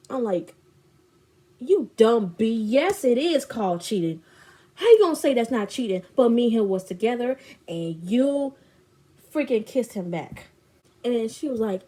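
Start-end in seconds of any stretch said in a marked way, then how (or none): 1.6-10.5 loud re-enacted telling-off; retold angry confrontation. She confronts friend over cheating, retold anger. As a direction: lay into her with indignation.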